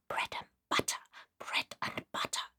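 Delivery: voiceless whisper, repeated. Acted. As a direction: breathe it out, no voice at all. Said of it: "in a whisper to herself".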